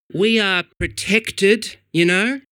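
drawn out and prim; indignant pigeon. A narrator's reading.

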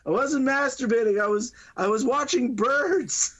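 high-pitched indignant denial; defensive protest. Retold or imitated. Teen calls back through the door, defensive and high-pitched.

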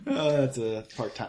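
usual speaking voice

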